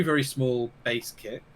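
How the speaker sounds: ordinary delivery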